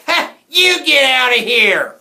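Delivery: slow, very loud, high holler; angry yell. A narrator's reading. Jack snaps back at the hecklers, yelling angrily. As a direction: yell back with full force. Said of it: "He rolled around and yelled".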